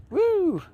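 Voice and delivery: a high, hammed-up wrestler woo; Ric Flair woo. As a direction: let out a mock Ric Flair whoop. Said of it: "I'm starting with the woos".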